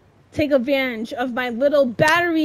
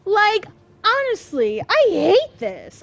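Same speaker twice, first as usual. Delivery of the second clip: high slow frustrated complaint; exasperated whine; 6 semitones higher, 3 dB louder, slower than usual. Real, not acted. Gamer whining about hating the level, high exasperated.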